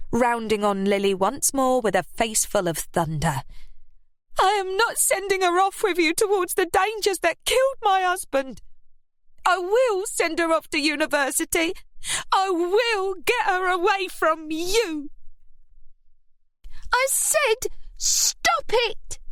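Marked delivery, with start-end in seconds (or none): 4.4-15.1 high, strained furious voice; mother refusing to let her daughter go; fury. A narrator's reading. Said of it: "in fury". 17.0-19.2 high, slow mock roar. A narrator's reading. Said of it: "Scarlett suddenly roared".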